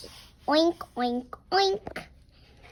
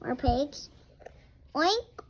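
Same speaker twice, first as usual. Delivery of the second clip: ordinary delivery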